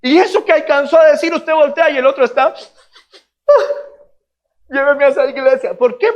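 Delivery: high, loud preaching; impassioned preaching. Real, not acted. Preacher telling how a listener was won over, impassioned preaching. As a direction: ring it out high to the congregation.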